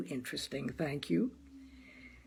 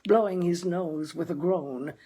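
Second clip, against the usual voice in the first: usual speaking voice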